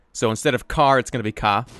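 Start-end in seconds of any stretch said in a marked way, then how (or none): 1.3-1.7 Boston "ka" with the R dropped, long open A; in a Boston accent say "ka", no R at all. Shown as a demonstration. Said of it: "you're gonna turn that R into an A H".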